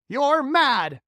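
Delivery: high loud outburst; cried. Acted. Earthworm protesting James' plan, high loud cry. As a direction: scoff in alarm. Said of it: "cried the earthworm".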